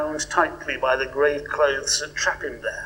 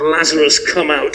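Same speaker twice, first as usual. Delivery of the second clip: command; 8 dB louder than usual. Shown as a demonstration. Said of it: "I find the command".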